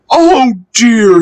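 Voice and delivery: high, loud mock-woeful lament; sad. A narrator's reading. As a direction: wail it mildly, like a storybook sulk. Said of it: "he thought sadly".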